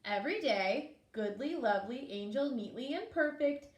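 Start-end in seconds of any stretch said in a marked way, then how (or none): none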